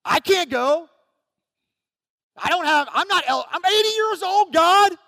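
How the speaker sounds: high-pitched, mock panicked protest; freaking out. Eighty-year-old Moses pleading with God, freaking out. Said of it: "He was freaking out also".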